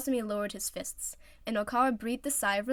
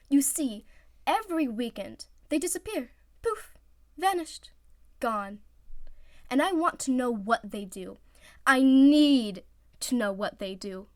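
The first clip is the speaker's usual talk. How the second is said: dramatic build; 3 semitones higher, 6 dB louder than usual. Acted. Oikawa to his team, dramatic building insistence. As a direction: build to need.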